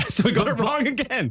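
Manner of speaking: high-pitched, laughing through the words; real laugh running into speech. Real, not acted. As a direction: crack up while telling the blunder. Laughs retelling their botched answer.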